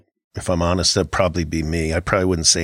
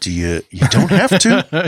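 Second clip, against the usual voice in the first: slow, laughing, higher and louder; 8 semitones higher, 4 dB louder than usual. Real, not acted. Laughing to his cohost about not yelling. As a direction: chuckle it out, drawn out.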